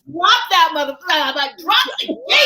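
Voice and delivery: loud, high yell at the book; mock yell. Reader yelling at the book, high and loud. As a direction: shout it at the page like a fan. Cheering on a kick-out scene.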